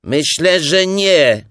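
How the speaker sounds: loud, high put-on denial; disguised voice. A narrator's reading. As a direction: call back pretending to be someone else. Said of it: "tym razem już innym głosem".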